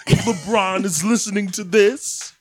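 slow, rising pundit monologue; Stephen A. Smith impression. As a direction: build it like a TV sports debater. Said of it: "Stephen A. Smith talks you through a poop".

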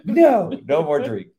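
high, laughing mock-stern no; mock stern husband. Wife imitating husband refusing her a drink, laughing mock-stern. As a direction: wag a finger through laughter. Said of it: "And he goes".

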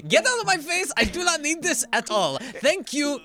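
high, loud angry rant; furious shopkeeper. Acted. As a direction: slam them with a shrill furious outburst.

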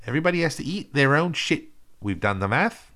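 mock Kiwi accent, earnest announcement; New Zealand accent. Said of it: "this is New Zealand accent".

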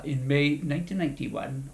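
usual speaking voice

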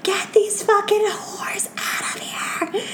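high, slow whispered mockery; whispered mocking mimicry. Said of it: "my weird whisper voice".

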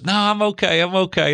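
high-pitched voice imitating his wife; imitated reassuring wife.

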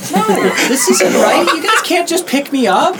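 put-on very high, loud child's whine; protesting kid. Game master as the kid dangled upside down, protesting kid. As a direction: squeal your outrage in a little boy's voice.

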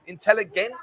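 "Intelligence" with its end said as "gents"; misspelling read literally. Shown as a demonstration. Host mocking a viewer's misspelling, "Intelligence" ending in "gents". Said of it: "No, it's Gents, isn't it?".

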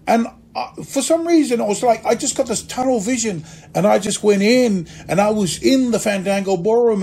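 ordinary talk, nothing marked

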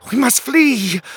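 high, loud cry of alarm; panicked king. A narrator's reading. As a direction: shriek it as an urgent plea to escape. Panicked king urges his men.

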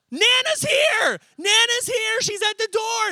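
very high, loud squeal of joy; over-the-top little boy shouting; excited kid. Retold or imitated. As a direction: imitate a child shrieking the happy news. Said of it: "He's all so excited".

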